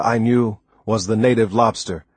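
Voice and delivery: ordinary delivery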